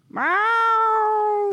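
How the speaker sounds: long meow replying to its owner; cat meow reply. Pretend. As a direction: answer as the cat. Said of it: "we have conversations like this".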